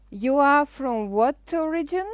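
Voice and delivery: normal talking voice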